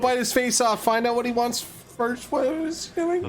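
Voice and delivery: high, panicked pleading. Acted. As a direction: beg him in a high voice. Pleading with character.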